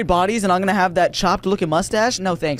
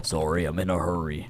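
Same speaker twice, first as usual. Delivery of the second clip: low creepy customer voice; 12 semitones lower, 8 dB quieter than usual. Acted.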